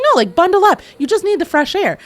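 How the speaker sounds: high, loud bossy cheer; high pushy urging. Retold or imitated. Wife urging her sick husband outside, high and bossy. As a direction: chirp it at him, bright and insistent.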